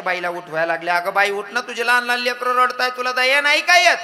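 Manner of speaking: loud calling of village women; calling loudly. Acted. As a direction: shout at her to wake up. Women shout to wake woman. (मोठ्याने हाका मारणाऱ्या बाया)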